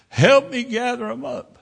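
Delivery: a shaken woman pointing out her scattered papers; frantic woman. Retold or imitated. Woman after the crash points out her papers, high and frantic. Injured woman asking for help.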